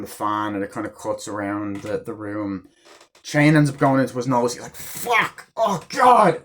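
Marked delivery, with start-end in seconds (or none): 4.8-6.4 higher pained cry, mock yelp of pain; retold pained yelp. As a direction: yelp like the chain just hit your nose. Retold man hurt by chain.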